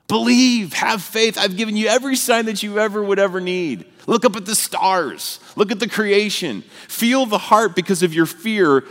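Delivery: God urging him to believe. Retold or imitated. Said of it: "God was like".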